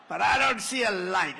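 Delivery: ordinary talk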